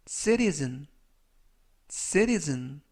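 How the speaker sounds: "citizen" with the t softened to a flap, "sirizen"; pronunciation model. Shown as a demonstration. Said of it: "이라고 발음하시면 되겠고요".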